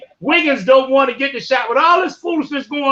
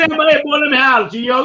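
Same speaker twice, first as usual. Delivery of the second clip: laughing Baltimore accent. Shown as a demonstration. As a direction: stretch out the Baltimore holler while cracking up. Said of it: "let me say in Baltimore".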